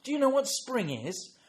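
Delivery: put-on high, loud talking-to-toddler voice; sing-song grandparent question; quizzing a child. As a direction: ask a little one with exaggerated interest.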